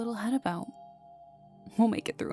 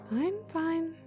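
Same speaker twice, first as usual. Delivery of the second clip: high-pitched, put-upon concession; exasperated giving in; 9 semitones higher than usual. Acted. Giving in to a picky partner, exasperated. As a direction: give in with a high, exaggerated lilt.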